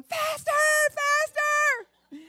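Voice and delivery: put-on high, loud thrilled kid chant; child in back seat urging daddy on, shrieking; gleeful child. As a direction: squeal it like a delighted small girl. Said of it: "And I'm going".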